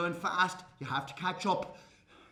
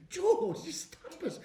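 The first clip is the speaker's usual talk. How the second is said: amused pleading; 8 semitones higher, slower than usual. Acted. Wife begs joking husband to stop.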